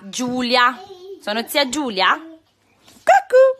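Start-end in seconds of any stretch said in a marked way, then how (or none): 3.0-3.4 high, loud cuckoo; high loud peekaboo; peekaboo call. Real, not acted. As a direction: pop out with a bright peekaboo. Peekaboo with toddler.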